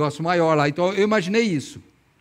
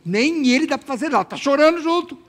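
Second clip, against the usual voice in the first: higher, indignant imagined complaint; exasperated Maria; 7 semitones higher than usual. Acted. Preacher imagines Maria seeing Jesus cry, exasperated. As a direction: play Maria rising into mock exasperation. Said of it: "sabe o que eu imagino?".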